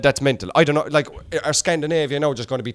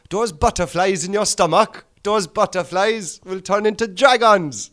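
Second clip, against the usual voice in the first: put-on higher Welsh accent drifting Caribbean; team talk impression; 5 semitones higher than usual. Comic imitating a man's team talk, accent drifting Jamaican. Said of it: "do you know your man's team talk?".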